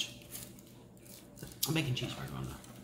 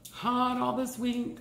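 drawn out; 6 semitones higher, much slower than usual. Real, not acted. Stretched complaint about heat.

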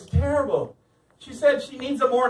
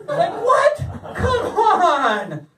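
incredulous; 9 semitones higher, much slower than usual. Real, not acted. Disbelief at Derek's reaction.

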